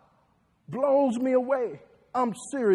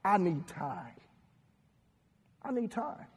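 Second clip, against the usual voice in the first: softer I need time; quiet plea; 3 semitones lower, 13 dB quieter than usual. Retold or imitated. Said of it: "Number one".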